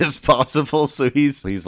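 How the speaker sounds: ordinary talk, nothing marked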